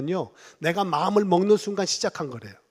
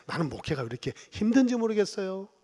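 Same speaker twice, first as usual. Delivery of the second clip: ordinary delivery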